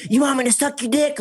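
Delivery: high-pitched propositioning voice; exaggerated come-on impression; mimicked street solicitor. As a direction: put on a high voice for the offer. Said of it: "I had a prostitute say".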